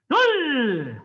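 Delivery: long, loud falling call; shouted count. Real, not acted. Instructor counting kicks for the class, shouted command. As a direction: bellow it out, stretched and falling.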